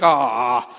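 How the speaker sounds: low, sinking "ah" of disappointment; disappointed groan. Retold or imitated. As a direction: groan like a let-down kid. Disappointed kids acted out.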